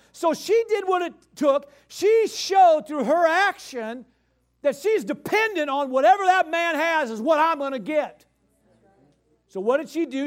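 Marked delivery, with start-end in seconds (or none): 0.1-8.2 rising preacher's fervor; preaching shout. Real, not acted. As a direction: proclaim her faith with force. Preacher building to climax.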